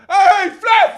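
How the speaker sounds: very high, loud jeering yell; mocking shout. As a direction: shout it across the diner to mock. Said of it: "the guys from Waffle House were like".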